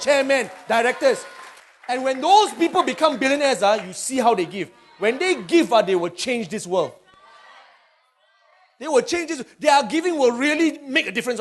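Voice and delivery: high, loud rising sermon; rally the church to the vision; preaching at full force. Acted.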